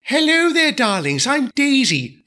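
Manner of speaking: high, airy grande dame; haughty diesel lady. Acted. Daisy greets the engines, high and haughty. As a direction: sweep in like a vain starlet.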